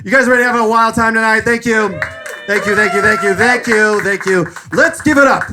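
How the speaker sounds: loud, fast, high emcee hype; comedy host firing up the crowd. Acted. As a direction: pump up the room like an emcee.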